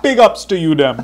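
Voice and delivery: mock Mohican accent. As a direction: throw the joke accent out high and loud. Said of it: "Mohican accent".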